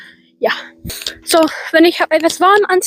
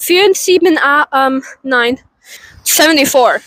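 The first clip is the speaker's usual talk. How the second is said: ordinary talk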